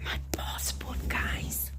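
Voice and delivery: excited hushed whisper. Real, not acted. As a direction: breathe it out like a secret. Secret excitement before reveal.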